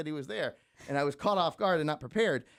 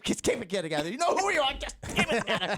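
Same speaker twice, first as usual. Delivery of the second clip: high mock-panicked self talk; self pep talk; 9 semitones higher than usual. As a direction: urge yourself to stay calm, half joking. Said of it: "That's what I was saying".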